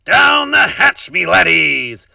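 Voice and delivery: very loud, high, slow captain's toast; pirate yelling. A narrator's reading. Braidbeard toasting his crew at dinner, loud slow yell. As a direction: bellow it while pounding the table.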